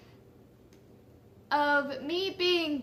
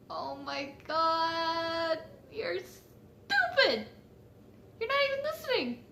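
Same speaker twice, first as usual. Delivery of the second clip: drawn out, then pitched up; acted exasperation; 3 dB quieter, slower than usual. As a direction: play up fed-up disbelief, stretching the words. Acted exasperated thoughts at the girl.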